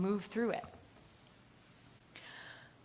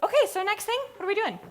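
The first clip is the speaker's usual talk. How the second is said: put-on high, loud bouncy kid voice; playful child; 14 semitones higher, 7 dB louder, faster than usual. Recovered child to her parent, high playful voice. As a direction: chirp it like a kid who's suddenly fine.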